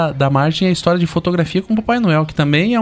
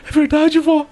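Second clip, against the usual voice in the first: put-on higher earnest kid; child voice; 8 semitones higher, slower than usual. Boy assuring grandma the eye is blue, earnest. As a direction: insist like a gullible boy. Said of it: "Eu virei pra minha avó e falei".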